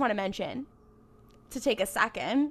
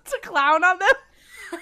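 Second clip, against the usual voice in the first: higher, drawn out through laughter; laughing; 8 semitones higher, 6 dB louder, much slower than usual. Real, not acted. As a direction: crack up while echoing the words. Laughing at co-host's confession.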